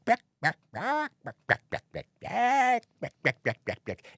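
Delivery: imitated chicken squawks and clucks; chicken clucking. Chicken Man working his way through the crowd, clucking. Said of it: "and I go".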